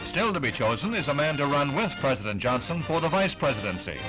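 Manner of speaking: ordinary talk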